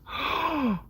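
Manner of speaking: imitated shocked gasp; lady gasping in mock shock; lady's gasp. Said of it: "she went".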